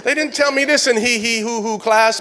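ordinary talk

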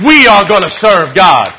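the same line again, now shouted; shouted declaration. Acted. Preacher repeating the vow to his church, shouting it. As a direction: hit it harder the second time.

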